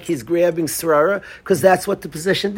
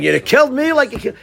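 higher, fast mock accusation, half-voiced; loud accusation; 6 semitones higher, faster than usual. Adversary taunting Moshe, a mild mock loud accusation. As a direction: blurt it out like a heckler, lightly. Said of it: "is being very loud about it".

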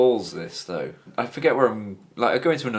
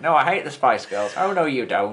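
put-on northern nurse accent; 2 semitones higher than usual. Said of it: "my generic northern nurse accent".

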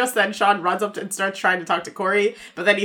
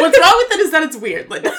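laughing retort; 7 semitones higher, 6 dB louder than usual. Real, not acted. Mock-answering Linda while laughing.

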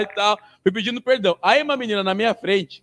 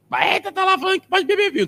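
mocking critic.

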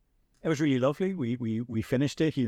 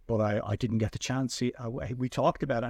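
normal talking voice

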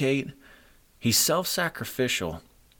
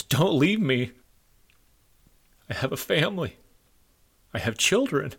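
choked-up, higher-pitched begging with long pauses; emotional plea; 6 semitones higher than usual. Retold or imitated. Wounded man grabs the medic to beg, emotional plea. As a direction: plead as a wounded man, genuinely moved. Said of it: "I'm getting emotional here".

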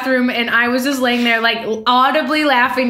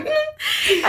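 squeaking through laughter; high, laughing almost crying; 12 semitones higher than usual. Real, not acted. Helpless laughter over texts.

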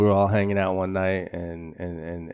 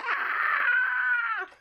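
a mock crowd scream, much higher; 6 dB quieter than usual. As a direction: shriek like a panicking crowd. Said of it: "everybody's like".

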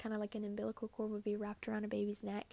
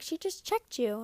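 mom quoted; 8 semitones higher, 6 dB louder than usual. Retold or imitated. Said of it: "And she's like".

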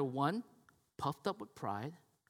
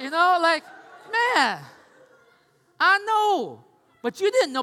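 loud and high, still giddy; a high, loud excited aside; 11 semitones higher, 10 dB louder, slower than usual. Real, not acted. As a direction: carry the giddy energy. Excited aside after laughing.

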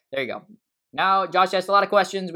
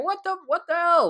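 pitched way up in stunned disbelief; high disbelief. Retold or imitated. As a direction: shriek it in mock outrage.

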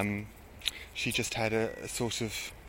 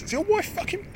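high, imitating the injured girl's outrage; shrill-free but high mock complaint from the floor; indignant fallen woman. Fallen girl scolding the crowd of bystanders, high mock outrage. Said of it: "And she goes".

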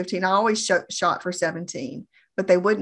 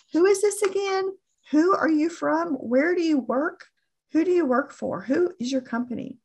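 high-pitched, put-on prospect voice; imitating a customer on a call, pitched way up; questioning customer; 8 semitones higher than usual. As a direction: fire off questions in a higher voice.

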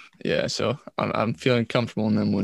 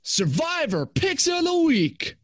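high, slow sung announcer; announcer jingle voice; slower than usual. Acted. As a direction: belt it like a show jingle. Plays segment jingle voice.

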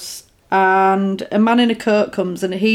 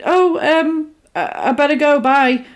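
higher, nervous stammered goodbye; flustered exit; 5 semitones higher than usual. Retold or imitated. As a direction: stammer it while backing away. Retelling film, girl flustered leaving.

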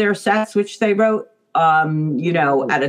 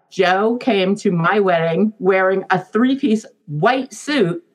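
usual speaking voice

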